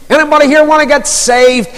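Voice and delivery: put-on high American-accented call to the room; imitated American street-preacher shout-out. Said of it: "in his American accent".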